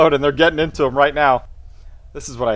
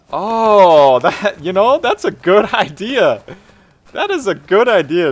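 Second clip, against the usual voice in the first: laughing; 7 semitones higher, 6 dB louder, slower than usual. Real, not acted.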